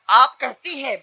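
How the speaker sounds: ordinary talk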